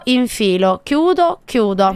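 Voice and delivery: ordinary talk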